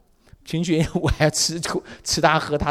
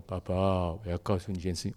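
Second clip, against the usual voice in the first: 1 sheepish son; 8 semitones lower, 7 dB quieter than usual. Retold or imitated. Son confesses again.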